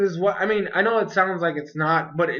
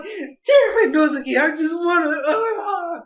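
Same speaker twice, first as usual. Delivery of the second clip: much higher and louder, crying in frustration; tearful outburst; 10 semitones higher, 4 dB louder, slower than usual. Retold or imitated. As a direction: cry out at the character through tears. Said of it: "I might have gotten a little bit too excited".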